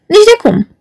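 sudden high exclamation; high startled denial; exclaiming. Acted. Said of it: "exclamă roșcatul".

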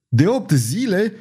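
high, alarmed shout of the king. A narrator's reading. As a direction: cry it out in shock, high. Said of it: "regele strigă".

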